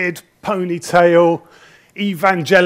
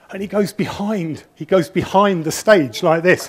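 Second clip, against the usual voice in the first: ordinary delivery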